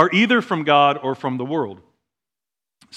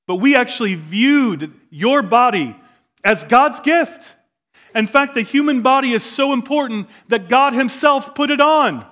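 raised, driving preacher's voice, higher and louder; preaching at full force; 7 semitones higher, 4 dB louder than usual. Acted. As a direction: preach it out to the congregation, building.

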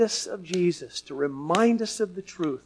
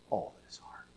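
whisper; 16 dB quieter than usual. Acted. Sermon line dropped to whisper.

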